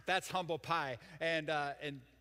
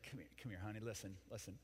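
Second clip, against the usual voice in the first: much softer, lower pastor soothing her; hushed comfort; 4 semitones lower, 5 dB quieter than usual. Acted. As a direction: murmur it close and gentle, like calming a crying lady. Pastor comforting the old lady.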